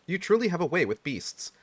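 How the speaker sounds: ordinary delivery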